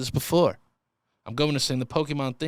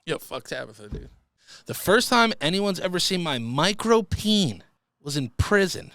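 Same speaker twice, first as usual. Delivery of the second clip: ordinary talk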